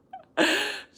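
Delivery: sudden loud high laugh; burst of laughter. Real, not acted. Laughing at her own joke to the viewers, a loud high laugh. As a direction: crack up out loud.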